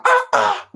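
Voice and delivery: high, drawn-out mocking "ah, ah"; incredulous ah ah. Real, not acted. Pastor scoffing at the congregation with a high "ah, ah". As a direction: exclaim in disbelief, pitched high.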